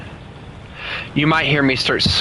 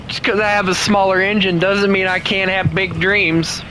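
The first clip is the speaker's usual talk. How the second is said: higher-pitched plucky little engine; little engine that could; 6 semitones higher than usual. Pretend. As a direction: stand up for yourself as a small plucky engine. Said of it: "I sound like the little engine that could".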